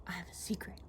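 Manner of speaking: imitating a small child's secret whisper; hushed, voiceless whisper. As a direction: lean in and whisper it like a son to his mom. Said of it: "he leaned in, he whispered".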